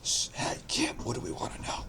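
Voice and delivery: hushed aside to a partner; urgent shushing, then all whispered; conspiratorial whisper. Acted. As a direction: whisper it as a secret question. Secretly asking partner for help.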